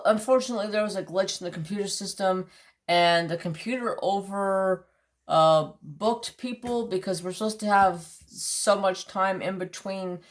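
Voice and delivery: ordinary talk, nothing marked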